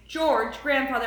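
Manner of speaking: ordinary delivery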